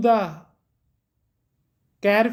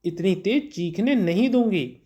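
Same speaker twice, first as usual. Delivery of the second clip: ordinary talk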